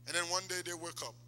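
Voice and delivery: normal talking voice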